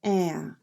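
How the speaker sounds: "ah" slow, as flat front æ; the cat vowel, tongue forward; right æ vowel. Shown as a demonstration. Teacher giving the correct A slowly. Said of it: "you say".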